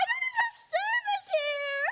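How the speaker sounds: high, whining wail; whiny cry. Acted. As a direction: whine in despair. Despair at no signal.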